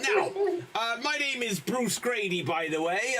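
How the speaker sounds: usual speaking voice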